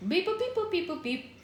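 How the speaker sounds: high beeping robot bleeps; toy robot voice. Pretend. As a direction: censor with robot beeps. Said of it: "Robot beep boop".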